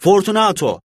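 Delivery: high, loud call of "Fortunato!". A narrator's reading. Montrezor shouting Fortunato's name into the niche, loud. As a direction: call his name into the wall. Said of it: "Yüksek sesle bağırdım".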